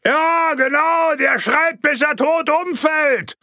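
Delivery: very high, loud heckling shout; heckler's shout. Acted. Heckler yells at the projectionist in a packed hall, shouting. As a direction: yell it across the hall.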